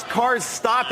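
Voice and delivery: ordinary talk, nothing marked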